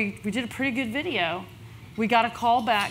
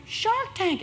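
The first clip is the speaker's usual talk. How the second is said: much higher, gleeful reveal; thrilled reveal; 13 semitones higher than usual. Retold or imitated. Partner reveals Shark Tank called, gleeful and high. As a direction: drop the big news with glee.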